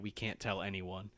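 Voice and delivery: low, very soft, secretive; awkward hush. Acted. Onlookers hiding the news, awkward hush. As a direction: drop it to an uneasy murmur. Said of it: "everyone gets silent and awkward".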